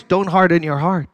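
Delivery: usual speaking voice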